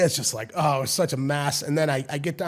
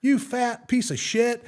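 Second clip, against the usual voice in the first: high, slow, drawn-out jeer; stretched-out high mock yell; kid hurling insult; 10 semitones higher, 4 dB louder, slower than usual. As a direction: imitate a bratty son yelling abuse. Retold kid insults his mother.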